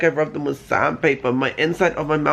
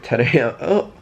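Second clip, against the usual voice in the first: usual speaking voice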